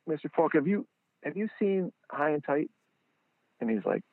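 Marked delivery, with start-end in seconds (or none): 0.1-2.7 high eager question; eager pitch. Retold or imitated. Fan pitching his ball to a star, high and eager.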